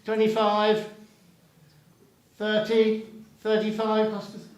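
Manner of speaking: brisk auction calling, counting up bids; auctioneer. Real, not acted. Auction bidding calls.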